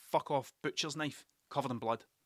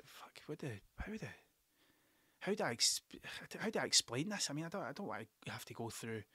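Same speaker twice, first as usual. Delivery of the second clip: under the breath, much softer, flustered; a dad swearing under his breath in the car; panicked mutter; 2 semitones lower, 10 dB quieter than usual. Retold or imitated. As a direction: mutter it so the daughter can't hear.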